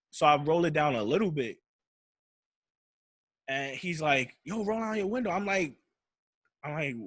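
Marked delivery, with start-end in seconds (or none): 4.4-5.3 high-pitched imitation of a demanding cop; pitched-up retold police command; officer's order. Voices the officer ordering him.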